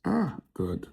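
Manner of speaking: high, groaning exasperation to the crowd; exasperated groan. Acted. Comedian turning to the audience, exasperated by the date. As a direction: throw your hands up with your voice.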